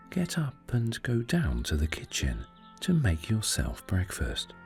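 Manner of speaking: plain reading voice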